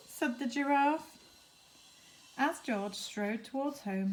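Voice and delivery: ordinary delivery, nothing marked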